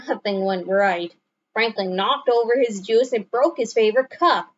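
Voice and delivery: ordinary delivery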